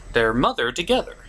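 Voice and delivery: ordinary talk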